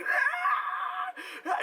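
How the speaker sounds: high piercing cry of pain; imitated pained cry. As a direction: yell out as if the trap just snapped shut. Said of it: "he really sounded like he had his foot in a bear trap".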